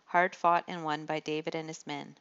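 plain reading voice

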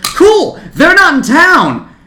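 very high, loud jeer; big taunting ballpark holler; heckling yell. Retold or imitated. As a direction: shout the stranger away.

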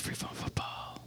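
whispered topic read; hushed reading of a card. Pretend. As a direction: breathe the words out.

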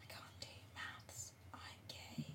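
very soft voiceless whisper; barely audible whisper. Real, not acted. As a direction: whisper it so nobody can hear. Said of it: "They can't hear you".

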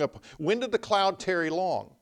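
ordinary talk, nothing marked

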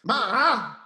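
put-on embarrassed teenager nagging his mother; whiny teen son.

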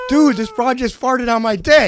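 high, loud retold complaint; loud buddy voice.

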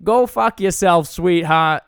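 put-on loud, slow Boston drunk mom; imitated boozy Boston brush-off; Boston accent. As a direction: heckle back in broad Boston. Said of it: "his mom who's drunk at the bar".

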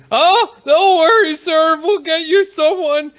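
very high, loud, slow squeaky clerk; panicky clerk voice. Acted. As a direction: squeal it like a flustered little clerk. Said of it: "He goes".

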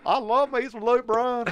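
hammed-up country star voice. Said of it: "That's how he talks".

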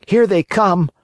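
high, a touch uneasy puppet; nervous little marionette; wooden puppet voice. A narrator's reading. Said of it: "Pinocchio said to himself".

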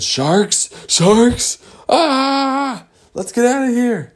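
high, rising alarmed squeal; mock panic. Fish hearing of sharks, high mock panic.

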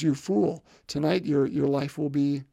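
ordinary talk

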